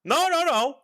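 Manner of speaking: high, loud no no no; high denial. Real, not acted. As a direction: protest it loudly.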